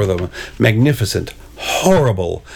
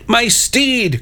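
high-pitched theatrical lament; hammed-up knightly grief; anguished cry; 12 semitones higher than usual. A narrator's reading. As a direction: cry out high for a lost companion. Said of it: "cried the Red Knight".

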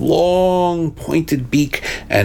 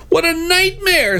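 hammed-up high-pitched panic; scream. A narrator's reading. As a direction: shriek in storybook horror. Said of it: "screamed the Blue Knight".

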